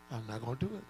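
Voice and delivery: low, quiet voice of God; quiet, unbothered refusal from God; God's reply. Acted. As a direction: answer him softly and firmly as God. God's repeated answer.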